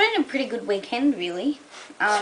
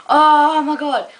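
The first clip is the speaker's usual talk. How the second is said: loud, slow oh my god; loud drawn-out oh my god; excited exclamation; 6 semitones higher, 10 dB louder, slower than usual. Real, not acted. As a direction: burst out remembering. Sudden recollection, loud.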